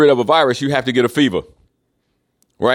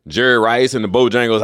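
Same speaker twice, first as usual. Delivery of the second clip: usual speaking voice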